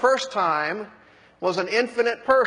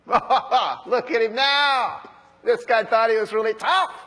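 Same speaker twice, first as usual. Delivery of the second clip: mocking jeer; 5 semitones higher, 4 dB louder than usual. Onlookers insulting Jesus on the cross, mocking. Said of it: "mocking him".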